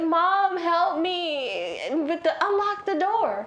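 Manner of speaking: hammed-up begging to be let in; mock desperate daughter. Bleeding daughter begging at door.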